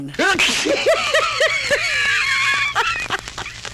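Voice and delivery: a big laugh that breaks upward. Real, not acted. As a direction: burst into a high shrieking laugh. Laughs at own remark.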